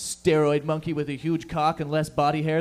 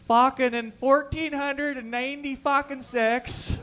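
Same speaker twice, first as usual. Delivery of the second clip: mock old-timey hockey player; 10 semitones higher, 4 dB louder, slower than usual. Imagines old-time hockey players.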